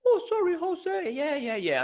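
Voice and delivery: high falsetto cartoon mouse, apologising; squeaky mouse apology, sliding down in pitch; Mickey Mouse. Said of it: "like Mickey Mouse".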